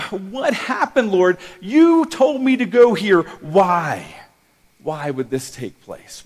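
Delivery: high, loud, pleading cry of despair; anguished plea to God. Retold or imitated. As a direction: beg God for answers, voice straining. Pastor retells crying out to God.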